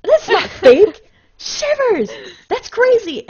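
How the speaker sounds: loud, high, near-tearful thrill; overwhelmed by genuine awe; real goosebumps excitement. Real, not acted. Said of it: "I can't act this. That's not fake".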